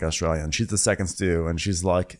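ordinary talk

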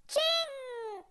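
high, drawn-out censored curse; mock cursing aunt. Aunt claiming her title, high mock curse. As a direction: stretch the cut-off swearword up high. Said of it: "Y dijo".

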